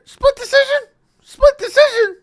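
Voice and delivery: very high, loud squeal of disbelief; shocked. Shown as a demonstration. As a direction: shriek it in high-pitched shock. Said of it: "when I'm just shocked".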